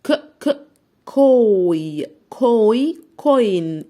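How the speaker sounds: كوين built sound by sound, slow and loud; blending sounds. Shown as a demonstration. Teacher blends كوين for young learners, slow and loud. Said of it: "تعالوا نشوف كده".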